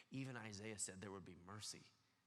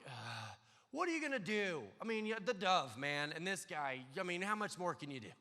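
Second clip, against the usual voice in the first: higher and louder, fed-up complaining; exasperated shrug; 5 semitones higher, 7 dB louder than usual. Retold or imitated. John complaining to the crowd, higher and louder exasperation. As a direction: throw up your hands in exasperation. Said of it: "he could have so easily been like".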